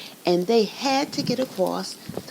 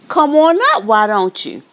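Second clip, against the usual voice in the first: loud, higher taunt; bold dare; 4 semitones higher, 9 dB louder than usual. Acted. Biggest goat daring the troll, loud.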